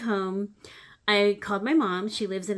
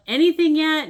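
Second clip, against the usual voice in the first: higher, louder call across the room; shout from the door; 8 semitones higher, 11 dB louder than usual. Retold or imitated. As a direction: call out from the doorway. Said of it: "she's coming in and out".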